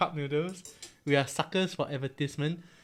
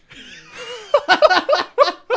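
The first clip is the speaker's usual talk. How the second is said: loud high laugh; 12 dB louder than usual. Real, not acted. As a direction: burst of high-pitched laughter. Reacting to the Christmas hat.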